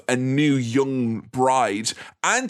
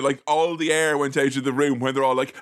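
usual speaking voice

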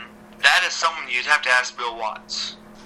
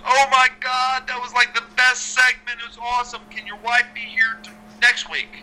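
excited Vince imitation; 8 semitones higher than usual. Vince raving about the segment.

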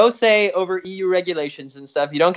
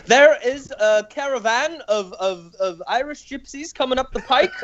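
Nigel Farage impression; 6 semitones higher than usual. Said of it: "I can't talk like him".

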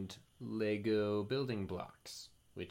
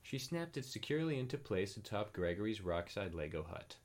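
plain reading voice

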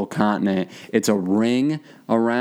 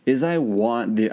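usual speaking voice

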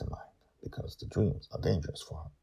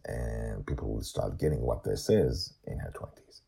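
plain reading voice

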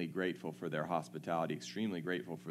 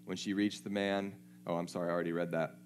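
ordinary talk

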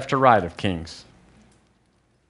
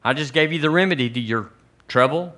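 ordinary talk, nothing marked

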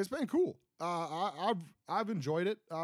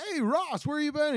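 mock cast greeting; 10 semitones higher, 5 dB louder than usual. Cast greeting Ross at reunion.

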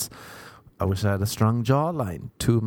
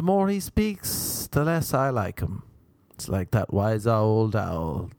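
poem recital; 6 semitones higher than usual. Shown as a demonstration. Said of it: "Can't beat an old poem".

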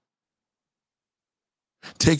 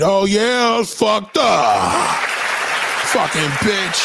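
hammed-up bitter loser, raised pitch; bitter good guy; 6 semitones higher, slower than usual. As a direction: sneer like a jilted nice guy. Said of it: "that's how he talks".

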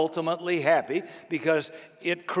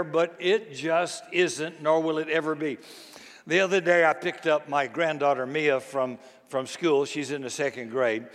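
ordinary talk

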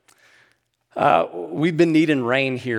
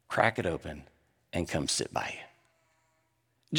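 tender, slow; 5 semitones lower, 5 dB quieter, much slower than usual. Retold or imitated. Mentor's tender question.

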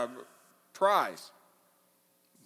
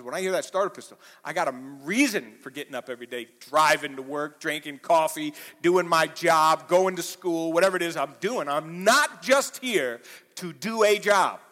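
full-force preaching; 2 semitones higher, 7 dB louder than usual. Acted. Preacher pressing his flock, in full-force preaching. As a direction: preach it out to the congregation, building.

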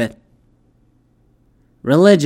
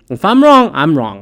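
emphatic; 7 dB louder than usual. Real, not acted. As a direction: belt If I'm wrong then drop. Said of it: "repeated concession".

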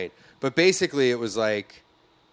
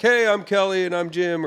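mock show host intro; 9 semitones higher, 5 dB louder than usual.

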